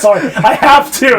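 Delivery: high, loud playful protest; emphatic protest. Real, not acted. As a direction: blurt it out loudly over the laughter. Laughing protest to the co-host.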